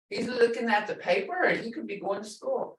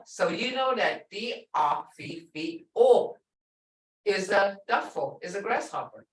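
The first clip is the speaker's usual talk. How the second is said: usual speaking voice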